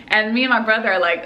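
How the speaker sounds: ordinary talk, nothing marked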